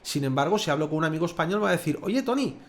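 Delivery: ordinary talk, nothing marked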